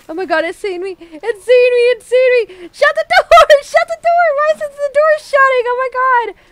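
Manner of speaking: rising to high, loud panic; urgent cries to get the door shut; panicked. Real, not acted. Player spotted by the creature, begging for a shut door, panicked.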